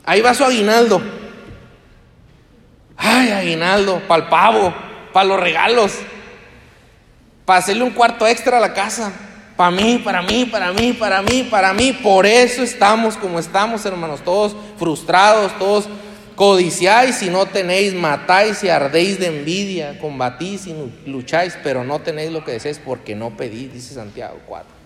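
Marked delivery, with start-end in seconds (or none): 3.0-11.9 loud, grasping mock voice; greedy believer. Preacher mocking a believer hoarding his bonus, loud and greedy. 11.9-23.7 loud, driving sermon voice; fiery preaching. Acted. Pastor rebuking a frustrated congregation, loud and fervent.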